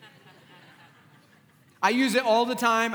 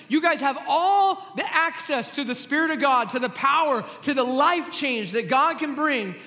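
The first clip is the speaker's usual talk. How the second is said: louder, higher preaching push on all; preaching shout; 6 semitones higher, 3 dB louder than usual. Real, not acted. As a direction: proclaim it to the whole room. Preacher drives home his point.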